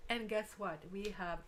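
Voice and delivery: usual speaking voice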